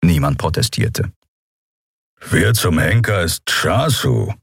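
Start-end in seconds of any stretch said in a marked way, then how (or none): 2.3-4.3 slow, grumbled question; grumbling. A narrator's reading. Kero reacts to Nelen's hasty report, grumbling. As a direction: grumble it, dragging the words. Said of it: "hatte Kero nach Nelens hastigem Report gebrummt".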